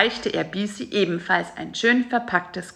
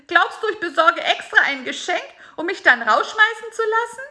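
disbelieving, then furious at being sent away; indignant high fly; 11 semitones higher, faster than usual. A narrator's reading. Fly Bisi, cast out on Christmas Eve, high and indignant.

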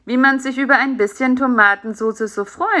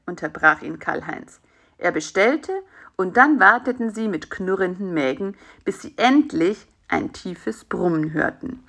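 ordinary delivery, nothing marked

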